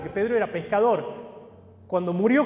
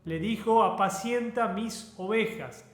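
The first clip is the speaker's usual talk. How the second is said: soft, measured reading of the Gospel passage; scripture reading; 5 dB quieter than usual. Acted. Preacher reads John to the congregation, softly and steadily. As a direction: read the text gently to the church.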